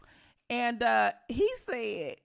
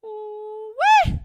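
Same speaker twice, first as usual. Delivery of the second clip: amazed ooh-wee; 12 semitones higher, 9 dB louder than usual. Real, not acted. Shocked at news story.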